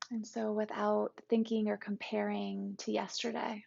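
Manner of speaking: calm, even meditation guide with long pauses; guided meditation. Acted. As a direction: guide listeners gently into the body, unhurried and settled.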